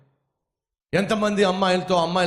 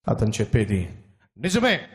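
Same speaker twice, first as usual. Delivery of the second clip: put-on calm old man; 5 semitones lower than usual. Lame man speaks calmly.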